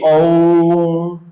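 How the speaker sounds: ओम with the ओ held long and level; ओम को लंबा खींचकर; drawn-out ओम. Shown as a demonstration. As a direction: chant ओम, stretching the ओ. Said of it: "ओ की ध्वनि है वो थोड़ी लंबी खिंच जाती".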